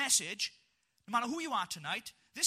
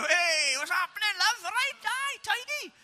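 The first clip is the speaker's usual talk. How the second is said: high, loud Welsh character hamming it up; mock Welsh greeting; 10 semitones higher, 7 dB louder, slower than usual. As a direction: greet the room as a cheery Welshman.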